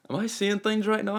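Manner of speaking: high-pitched incredulous thought; acted-out disbelief. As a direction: wonder aloud in astonishment. Acts out disbelief at the shirt.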